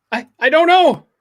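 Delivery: high, loud, stuttered reply; panicked stammer. Acted. Brandon answering the scared kid, panicked stammer. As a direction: blurt it high and loud, tripping on the first word.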